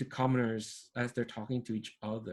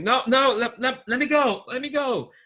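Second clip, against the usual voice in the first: high, loud cries to be let go; desperate shouting; 12 semitones higher, 7 dB louder than usual. Acted. Squire shouting as he is dragged off, loud and high. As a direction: yell it out, struggling against someone. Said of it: "a voice shouting".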